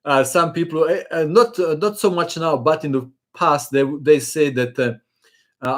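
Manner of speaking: ordinary delivery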